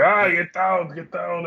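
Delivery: hammed-up warning yell under fire; mock urgent shout. Retold shootout, warning shouts.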